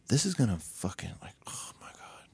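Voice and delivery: unvoiced awed whisper. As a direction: whisper it in awe. Brothers whisper in awe.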